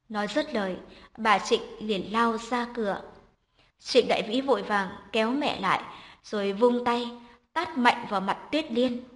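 usual speaking voice

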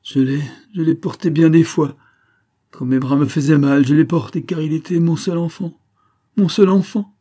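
high, loud, anguished mother; distraught delirious outcry; anguished delirium. A narrator's reading. Delirious mother cries for her child.

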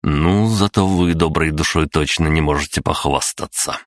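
usual speaking voice

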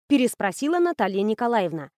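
ordinary delivery

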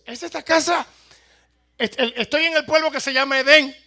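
loud, high calling out; shouting preacher. Acted. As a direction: shout to be heard inside. (predicador gritando)

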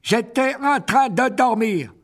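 high, loud, slow irritated creature voice; annoyed spider. Acted. Aragog orders the strangers killed, annoyed and loud. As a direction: snap the order like an irritated old monster. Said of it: "cliqueta Aragog d'un ton agacé".

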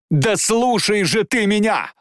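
high, drawn-out cry; desperate plea. Acted. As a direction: cry out to make him listen.

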